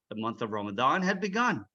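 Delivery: plain reading voice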